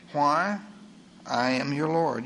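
normal talking voice

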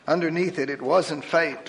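ordinary delivery

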